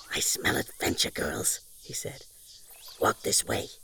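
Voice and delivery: hushed, mysterious whisper. Acted. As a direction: whisper it like a secret. Dog's mysterious voice.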